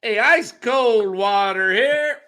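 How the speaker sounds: high, slow water vendor call; stadium vendor hawking. Retold or imitated. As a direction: stretch it like a ballpark hawker. Vendor imitation.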